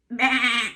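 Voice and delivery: loud short sheep bleat; mock bleat.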